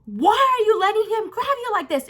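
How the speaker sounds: high, insistent demand; acted alarmed scolding. Teller to the friend she pulled away, alarmed scolding. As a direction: scold a friend in shrill-pitched disbelief. Acts confronting her friend.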